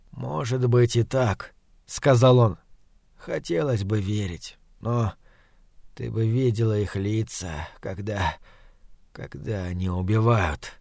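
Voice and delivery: soft, low, halting speech; weak dying man. A narrator's reading. Dying man doubts her about the killers, weak and halting. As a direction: speak softly with effort, pausing.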